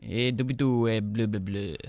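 a light mock Quebecois French accent. Joking that his Australian sounded French, now mock Quebecois accent. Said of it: "Little bit of Quebecois".

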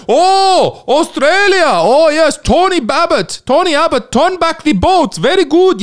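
party official impression. Party official calls him up, high eager accented voice. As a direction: play the eager official on the phone. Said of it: "He's like".

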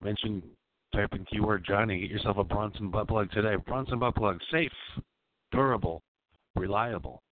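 high, loud hyped commercial pitch; ad read. Acted. Host reading a fake sponsor spot, hammed-up announcer. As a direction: oversell it like a cheesy ad.

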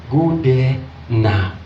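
slow, drawn-out order; retold insistent push. Inner prompting pushes the preacher to go, slow insistence. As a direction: stretch the words out insistently. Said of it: "The thing was just pushing".

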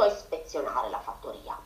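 usual speaking voice

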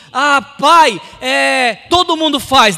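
stall, then blurt the excuse; making excuses. Acted. Son excusing himself to his father, loud hedging excuse. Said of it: "Ele não fala assim".

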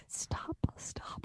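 imitating an urgent whisper; hushed, voiceless repeat of a warning. Host repeating what she thought co-host said, whispered. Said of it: "I thought you said".

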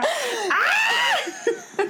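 very high-pitched mock fox shriek; fox scream imitation. Shown as a demonstration. Demonstrating England's night-time foxes for co-host, shrieking like one. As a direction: let out a piercing animal screech. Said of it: "en la noche gritaban así".

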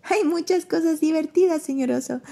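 high, giggly little possum. Acted. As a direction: chirp it with a giggle. Pepe laughing at bear.

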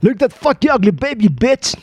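a mock Australian English voice; hammed-up Aussie insult; lightly voiced Australian accent. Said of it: "en inglés dice".

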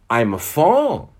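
the fawn crying out in delight. Acted. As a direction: burst out with joy. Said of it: "it cried out in a voice of delight".

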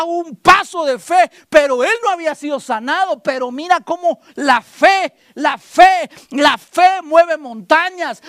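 loud, high preaching climax; full-force preaching. Acted. Pastor urging the sick to rise, loud preaching. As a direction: drive it home with force.

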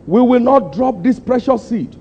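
high, pleading declaration; impassioned vow. Retold or imitated. Preacher quoting his old letter to the church, high and impassioned. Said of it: "I said".

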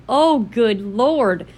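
very slow, drawn-out exclamation; retold amused disbelief. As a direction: stretch every word in mock dismay. Retelling amused shock at game.

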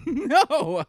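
high, loud burst of laughter; laughing hard. Real, not acted. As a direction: crack up mid-word.

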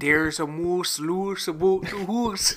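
slow, hammed-up Canadian accent; mock Canadian accent. Co-host shows off his Canadian accent, slow and hammed-up. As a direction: draw it out in a jokey Canadian accent. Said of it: "my Canadian accent".

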